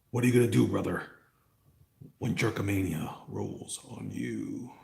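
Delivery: a lightly done wrestler promo, slowing down; mock Hulk Hogan growl, slowing at the end; Hulk Hogan impression. Streamer imitating Hulk Hogan to chat, lightly done. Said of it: "I don't sound like Hulk Hogan".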